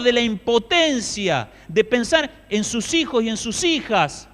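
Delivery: loud, high preaching cry, slowing in places; impassioned preaching. Acted. Preacher to the congregation about the grieving soldiers, loud impassioned preaching.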